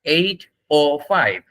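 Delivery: very slow, separated words; demonstrated time phrase. Shown as a demonstration. Teacher showing students how to say it, slow model. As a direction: draw out "eight oh five" word by word. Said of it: "আপনি এখানে এইভাবে বলবেন".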